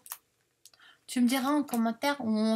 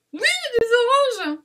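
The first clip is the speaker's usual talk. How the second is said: high, loud cry of delight; real excited shout; 12 semitones higher, 9 dB louder than usual. Real, not acted. Vlogger spotting oranges among the papers, shouting with excitement. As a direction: shout it out, pitch leaping way up. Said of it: "pardon, j'ai crié".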